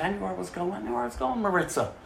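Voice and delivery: usual speaking voice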